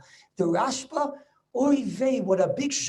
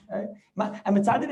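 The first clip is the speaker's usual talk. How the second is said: ordinary delivery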